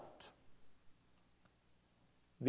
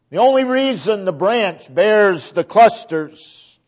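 raised, driving sermon voice; preaching at full force; 5 semitones higher, 5 dB louder than usual. Acted. Preacher to his congregation, driving the point home at full force.

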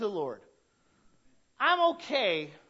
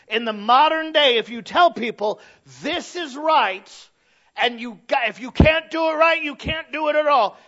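loud, high preaching that builds to a shout on right; full-force preaching; 6 semitones higher, 11 dB louder than usual. Acted. Pastor preaching the rule to the church, loud and rising. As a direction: drive the rule home to the congregation.

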